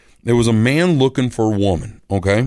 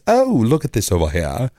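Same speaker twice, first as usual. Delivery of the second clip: put-on British accent. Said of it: "So they've all got accents".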